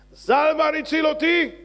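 high, loud, stretched cry; loud, ringing climax; exultant proclamation. Acted. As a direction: proclaim the rescue at full voice.

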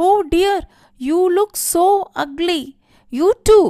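high, loud, horrified exclamation; acted shriek. A narrator's reading. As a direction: cry out aghast at the sight. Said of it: "she shrieked out in reply".

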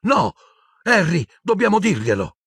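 put-on high, desperate girl's plea; broken-voiced cry. A narrator's reading. Hermione urging Harry to tell, desperate high cry. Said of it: "urlò Hermione con voce rotta".

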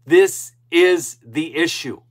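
fervent, pounding statement of the point; emphatic declaration. Real, not acted. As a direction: drive it home word by word. Repeats point after pause.

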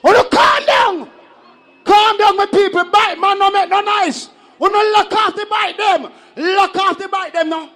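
high, loud shouted orders to the crowd; shouting. Real, not acted. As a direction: roar over the noise to stop the bites.

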